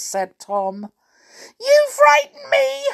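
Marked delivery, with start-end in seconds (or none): 1.6-2.8 tiny, high, loud squeal; squealing mouse. A narrator's reading. Frightened clockwork mouse squealing at blue Amelia. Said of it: "squealed the Clockwork Mouse".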